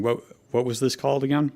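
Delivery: ordinary talk, nothing marked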